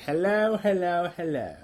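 corrected goofy hello; weird voice. Shown as a demonstration. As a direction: hit the first hello high and let it drop. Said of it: "Actually, it's more like".

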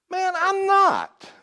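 loud, high, drawn-out protest; emphatic denial. Acted. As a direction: deny it emphatically, voice jumping way up. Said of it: "A little more emphatic this time".

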